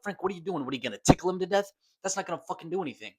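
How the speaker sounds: mock Peter yelling. Peter shouts at Frank, fast and exasperated. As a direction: rattle it off, fed up and loud.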